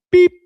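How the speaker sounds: imitated phone beep. Host mimics the call cutting off, high sharp beep.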